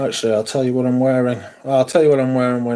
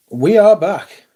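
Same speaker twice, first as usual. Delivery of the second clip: louder and higher, proclaiming the return; triumphant announcement; 6 semitones higher, 6 dB louder than usual. Real, not acted. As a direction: call it out like a victory. Triumphant return after hitches.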